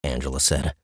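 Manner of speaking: plain reading voice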